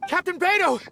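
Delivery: high, urgent call. Acted. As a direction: hail the captain from a distance. Calling out to the captain.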